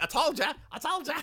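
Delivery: very high, loud comic crowing; hammed-up I-told-you-so voice; vindicated bear. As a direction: squeal it in mock vindication. [name] crows he was right.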